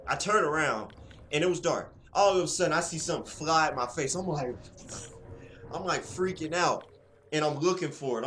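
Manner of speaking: high, loud frightened retelling; excited. Retold or imitated. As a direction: relive the scare breathlessly. Retelling a scare by a bug.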